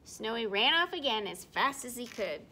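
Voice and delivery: plain reading voice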